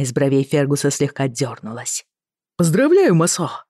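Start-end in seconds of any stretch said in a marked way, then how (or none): 2.6-3.6 bright, loud congratulations; excited. Acted. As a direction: burst out with delight. Congratulating friend on commission.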